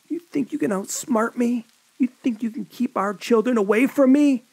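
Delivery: high, loud enraged taunt; savage snarl. A narrator's reading. John taunting Annette about the children, enraged. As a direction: spit it at her in fury.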